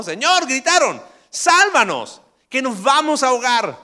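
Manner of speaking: high, loud cry for rescue; panicked shout. Retold or imitated. Disciples in the sinking boat shout loud and high. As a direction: scream for help in the storm. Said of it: "Grité porque dice gritaron".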